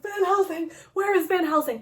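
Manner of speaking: high, urgent mock call; acted-out anxious cry; calling out in alarm. Searching for Van Helsing.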